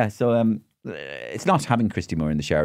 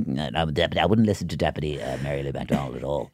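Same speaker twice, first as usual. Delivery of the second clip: low, softened parliamentary rebuttal; low-voiced politician; 4 semitones lower, 4 dB quieter than usual. Mimicked politician answers a deputy, low and subdued. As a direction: drop down and murmur a dismissive reply.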